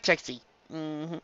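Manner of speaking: ordinary delivery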